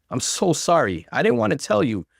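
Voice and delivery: high, tearful rush of accusation; mild acted sobbing plea; crying. A narrator's reading. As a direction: play the wounded victim through tears. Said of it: "she cried".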